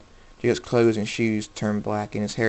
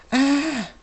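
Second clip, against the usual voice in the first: pained cry; 4 dB louder than usual. A narrator's reading. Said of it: "The girl says".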